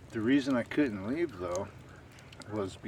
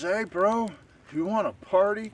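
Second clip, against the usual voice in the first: put-on drunk party bro; 11 semitones higher, 9 dB louder than usual. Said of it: "the guy had a Swedish accent".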